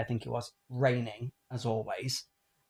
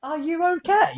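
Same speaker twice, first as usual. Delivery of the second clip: concerned call; 13 semitones higher, 7 dB louder than usual. Retold or imitated. Retold moment checking on daughter.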